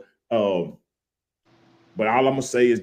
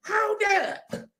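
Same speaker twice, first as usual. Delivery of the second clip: high mock yell; imitating the co-host cutting in; mock hold up; 5 dB quieter than usual. Host mimics the co-host interrupting with a high mock yell. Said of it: "jumped in front of the train talking about".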